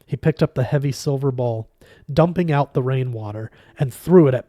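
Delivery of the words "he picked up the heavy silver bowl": ordinary delivery, nothing marked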